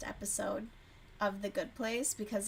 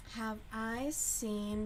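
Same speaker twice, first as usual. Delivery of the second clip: ordinary talk, nothing marked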